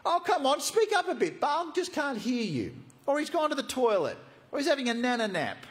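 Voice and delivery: very high, jeering goading; mocking. Elijah sledging the prophets of Baal, mocking. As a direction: needle them in a loud, high taunt. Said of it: "Elijah began mocking them".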